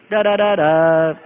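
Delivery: slow, low da-da-da-daaa; Morse code demo. Shown as a demonstration. Explaining the war signal, low slow Morse imitation. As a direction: intone the signal low and evenly, last note held. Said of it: "Morse code for V".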